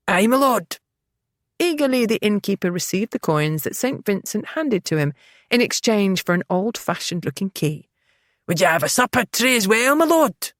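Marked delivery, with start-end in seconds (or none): 0.1-0.8 cheerful Scottish burr; obliging Scots innkeeper voice. A narrator's reading. Innkeeper answering the lord, Scottish accent. 8.5-10.5 cheerful Scottish burr; obliging Scots innkeeper voice. A narrator's reading. Innkeeper answering the lord, Scottish accent.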